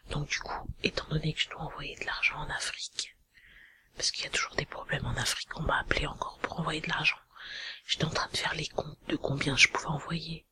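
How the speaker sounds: hushed whispered confiding. Real, not acted. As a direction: whisper it like a secret about money. Parle tout bas tout du long. (chuchoté, en confidence)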